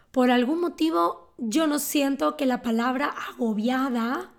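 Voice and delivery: ordinary delivery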